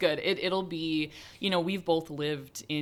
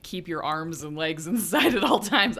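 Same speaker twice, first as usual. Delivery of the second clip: ride announcer; 3 semitones higher, 6 dB louder than usual. Pretend. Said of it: "I'll do an on-ride spiel".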